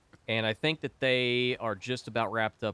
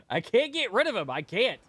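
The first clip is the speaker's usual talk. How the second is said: exasperated outburst; 10 semitones higher, 4 dB louder than usual. Real, not acted.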